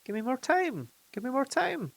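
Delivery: high, panicky cry; wanting to shout. A narrator's reading. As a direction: beg for time in panic. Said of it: "He wanted to shout".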